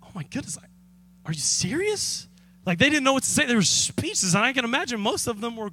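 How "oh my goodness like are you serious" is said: stunned disbelief. Retold or imitated. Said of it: "they were stunned. They were shocked".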